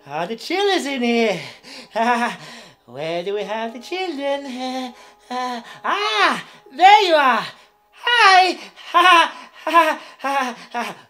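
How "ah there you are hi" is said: loud, high clown greeting; big gleeful hello; delighted calling out. Acted. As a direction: call out to children in the crowd. Clown greets children in audience.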